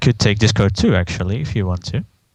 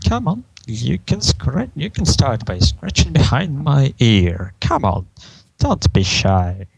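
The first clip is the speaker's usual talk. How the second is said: coaxing villain, starting high and sliding down; Discord; 3 semitones higher than usual. Acted. Discord invites scratching behind his ear, playfully coaxing. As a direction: coax slyly, slowing at the end. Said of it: "Discord starts".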